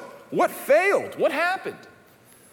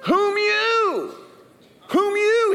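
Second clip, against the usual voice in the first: Adam blaming; 3 dB louder, much slower than usual. Acted. Adam blaming God, acted.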